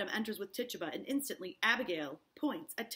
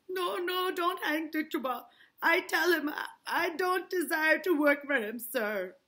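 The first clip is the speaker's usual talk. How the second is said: a touch of frightened begging, higher; mild pleading; 8 semitones higher, 8 dB louder than usual. Acted. Tituba begging not to be hanged, lightly frightened. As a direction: beg for her life.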